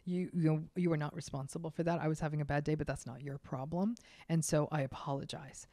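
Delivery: a soft, quiet, sincere apology. Shown as a demonstration. Owning up to a coworker after snapping, soft and sincere. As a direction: own the mistake gently and softly. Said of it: "very quickly say".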